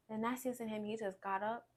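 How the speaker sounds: usual speaking voice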